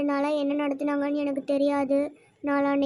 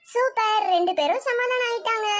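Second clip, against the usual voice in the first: much higher, bright delighted praise; excited cheer; 6 semitones higher than usual. Acted. Teacher cheers that the two children made peace, excited and high. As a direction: celebrate the two making up.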